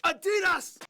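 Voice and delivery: high-pitched shout; sudden high exclamation; shouted brand name. Acted. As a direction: yell it out high, like a slogan. Shouted brand name clip or parody.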